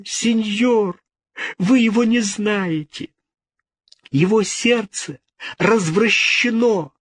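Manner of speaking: put-on high, pleading girl's voice; desperate pleading. A narrator's reading. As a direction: beg for rescue, voice high and strained. Ginevra pleading, high pitch. (высокий умоляющий голос девушки)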